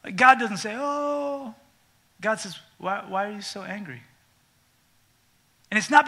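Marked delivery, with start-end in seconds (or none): 0.8-4.0 softer, tender asking; God asks kindly; gentle God. Acted.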